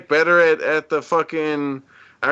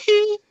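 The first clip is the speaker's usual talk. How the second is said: one sharp high falsetto hee; squeaky pop-star yelp; [name] hee. Retold or imitated. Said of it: "He's like, he's like [name]".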